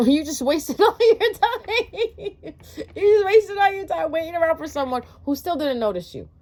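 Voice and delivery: high, loud laughing delivery; can't keep a straight face. Real, not acted. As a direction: laugh your way through it. Laughing through the line.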